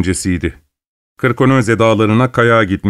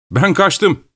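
cheerful call; 7 semitones higher than usual. A narrator's reading. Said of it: "diye neşeyle bağırdı".

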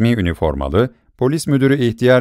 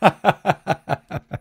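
put-on merry drunken laugh; hearty belly laugh; loud guffaw; 8 semitones higher, 4 dB quieter than usual. A narrator's reading. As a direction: burst out laughing at them. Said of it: "bir kahkaha attı".

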